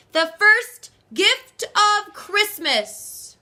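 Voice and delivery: high, loud, slow proclamation; calling out. A narrator's reading. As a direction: announce it to the whole crowd. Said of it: "and called out".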